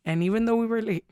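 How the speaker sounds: ordinary delivery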